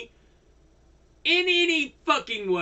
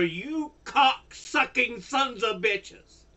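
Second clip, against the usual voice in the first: ordinary delivery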